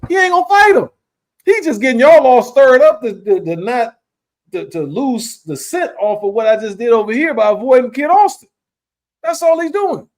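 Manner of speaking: high, loud exasperated rant; heated rant. Real, not acted. Host ranting to co-host about a ducking fighter, high exasperated.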